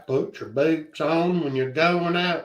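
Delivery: slow, drawn-out Texas drawl; Texas accent. Acted. Teasing a friend who avoids the Texas accent, mock Texas drawl. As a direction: stretch the vowels like a mock Texan. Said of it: "local god-awful Texas accent".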